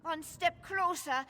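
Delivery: high, panicky threats, backing away; frightened threat. Acted. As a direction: bluff with a knife, terrified. Cornered, threatening with a knife.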